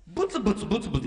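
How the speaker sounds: a fast, rattled-off mock grumble; quick grumble. Preacher mimics a grumbling coworker, fast mock grumble.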